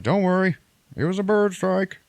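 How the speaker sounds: high, loud mock calm; mock reassurance. Pilot calming the passengers, high mock reassurance. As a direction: brush it off brightly.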